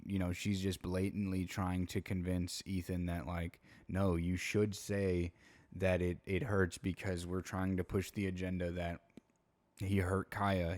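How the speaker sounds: usual speaking voice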